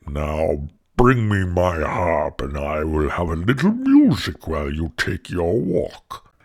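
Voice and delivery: low, heavy giant voice. A narrator's reading. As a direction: order it like a lumbering ogre. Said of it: "he said".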